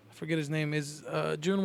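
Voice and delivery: ordinary talk, nothing marked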